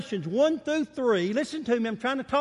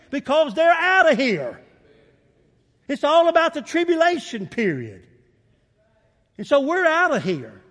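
rising preacher fervor; 4 semitones higher, 6 dB louder than usual. Real, not acted. Preacher proclaiming to the church they're out of here, excited. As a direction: lift the church with it. Sermon climax on the rapture.

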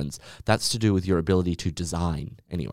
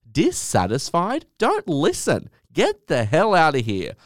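indignant; 7 semitones higher, 3 dB louder than usual. Real, not acted. Host rants at a bad review.

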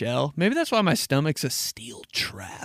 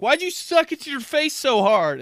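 high scolding mom; high exasperated mom voice; 12 semitones higher, 5 dB louder than usual. Retold or imitated. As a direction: nag like an annoyed mother. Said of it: "She'd be like".

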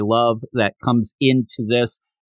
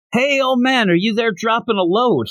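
mock yell; 8 semitones higher than usual. Goku runs in looking for the old man, mock yelling. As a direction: imitate calling through a house. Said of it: "starts yelling".

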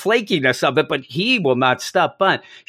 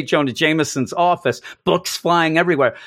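normal talking voice

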